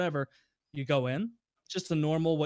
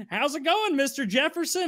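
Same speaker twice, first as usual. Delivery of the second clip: much higher, bright customer-service voice; cheery clerk greeting; 11 semitones higher, 3 dB louder than usual. Retold or imitated. Rental clerk greeting a furious customer, high fake-cheery. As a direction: put on the fake-friendly clerk.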